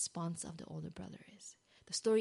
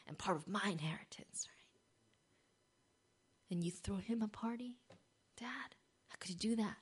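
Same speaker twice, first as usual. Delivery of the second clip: hushed resentment; 4 dB quieter than usual. Acted. Resentful brother, voiced in retelling.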